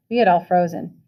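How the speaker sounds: ordinary delivery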